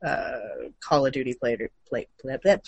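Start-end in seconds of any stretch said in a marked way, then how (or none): none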